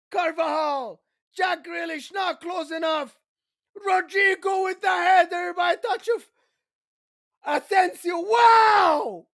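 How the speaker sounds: excited commentary coming down from a scream; awed live play-by-play. Real, not acted. Commentator marvels that Real Madrid never die, excited play-by-play.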